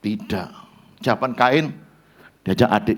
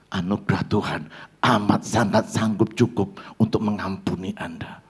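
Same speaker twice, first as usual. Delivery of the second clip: quiet appeal; 5 semitones lower, 6 dB quieter than usual. Acted. Intimate altar-call appeal.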